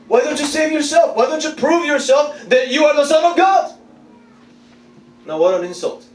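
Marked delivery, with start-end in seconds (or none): 0.1-3.7 high, insistent jeering; mock him with a pitched-up challenge; mocking taunt. Crowd insulting Jesus on the cross, mocking. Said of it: "people are mocking Jesus".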